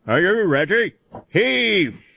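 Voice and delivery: loud, high call to heave. A narrator's reading. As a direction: order the pull. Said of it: "called the Fat Controller".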